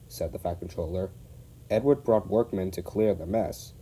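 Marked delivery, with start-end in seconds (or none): none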